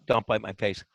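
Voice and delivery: low, soft pet talk. Real, not acted. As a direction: tease the dog gently, dropping low. Talking to a dog.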